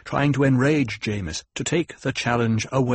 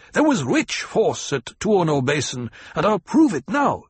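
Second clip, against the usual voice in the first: furious threat; 7 semitones higher, 3 dB louder than usual. A narrator's reading. Jameis continues his roar.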